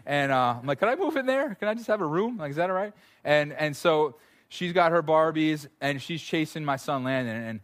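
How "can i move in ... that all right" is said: high, mock-incredulous plea; playful fake begging; mock pleading. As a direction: beg for a room in the dollhouse, tongue in cheek. Dad joking about the Barbie house.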